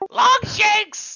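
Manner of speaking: loud high yell across the field. Acted. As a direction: call the name out at full voice. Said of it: "so you're just yelling".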